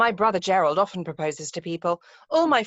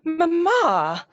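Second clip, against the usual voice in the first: startled protest; 7 semitones higher, 4 dB louder than usual. Acted. Daughter protesting mother's interruption.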